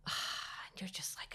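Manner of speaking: a sigh that drops into a slow, soft whisper. Retold or imitated. Telling a friend about the silent scan, whispered with a sigh. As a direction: let the breath go and barely voice it.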